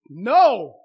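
sudden loud emphatic No!. Shown as a demonstration. Preacher modelling the answer he wants from the congregation, loud. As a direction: bark the No! to show them. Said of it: "There should be a lot more emphatic no's".

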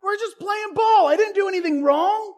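high, loud, whiny denial; defensive kid protest. Retold or imitated. [name] denying he did wrong to Rusty, loud whiny protest. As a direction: protest your innocence like a kid. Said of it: "I'm like".

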